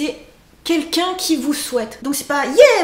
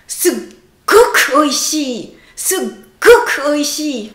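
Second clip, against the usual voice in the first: sugoku oishi slow and louder, leaning into it; emphatic sugoku oishi; 4 dB louder, much slower than usual. Shown as a demonstration. Teacher adding more feeling for super tasty, emphatic. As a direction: really sell how tasty it is. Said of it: "Encore plus de sentiments".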